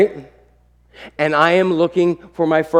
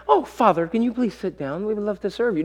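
lightly mocked sweet, dutiful family; softer, mock-reverent politeness; 4 semitones higher, 6 dB quieter than usual. Family pretending to serve father, mock politeness. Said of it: "Nobody is just like".

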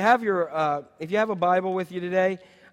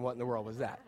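much softer and lower, half under the breath; muttered confusion; 8 semitones lower, 13 dB quieter, faster than usual. Pretend. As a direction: mumble it like a puzzled churchgoer. Same question, churchgoers leaving.

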